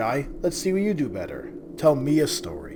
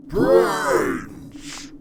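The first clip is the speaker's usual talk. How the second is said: zombie moan; 5 dB louder than usual. Acted. [name] signs off zombie style.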